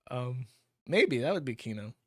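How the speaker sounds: normal talking voice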